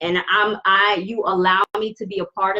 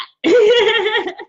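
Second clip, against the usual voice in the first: loud, high burst of laughter; laughing; 12 semitones higher, 6 dB louder than usual. Real, not acted. Reader laughing at herself for crying a lot, high laugh.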